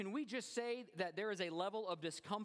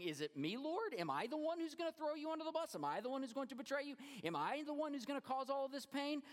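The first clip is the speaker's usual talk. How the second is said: pleading questions, pitched much higher; worried disciple asking the Lord, much higher; anxious disciples pleading; 9 semitones higher than usual. Retold or imitated. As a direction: plead like a frightened follower.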